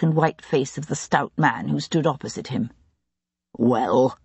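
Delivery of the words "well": demanding. Acted. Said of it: "demanded Jarvis".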